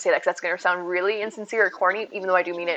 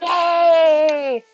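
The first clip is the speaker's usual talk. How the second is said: pretend thrill cheer; 7 semitones higher, 5 dB louder than usual. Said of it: "pretend you're on a roller coaster and be like".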